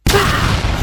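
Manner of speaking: loud, high "pow"; punch sound. Acted. Reader cheering Donatella's comeback, loud punch noise. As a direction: fire it off like a landed blow. Cheering each verbal hit.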